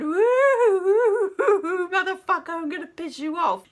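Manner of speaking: high, loud spooky woo with a laugh; mock a cackling game ghost teasing you; taunting ghost. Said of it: "I can still hear the ghost going around".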